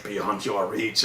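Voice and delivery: calm, grave father's answer; mock solemn. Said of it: "Jor-El is like".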